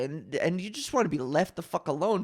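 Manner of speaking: usual speaking voice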